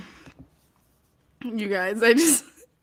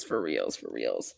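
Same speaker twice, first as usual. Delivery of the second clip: much lower, softer mock bass voice; deep voice; 8 dB quieter than usual. Shown as a demonstration. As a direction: sink into a comic deep growl. Repeats phrase, dropping voice.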